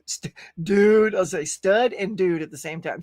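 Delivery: normal talking voice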